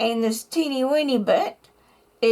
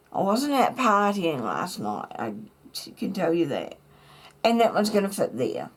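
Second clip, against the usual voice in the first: ordinary talk, nothing marked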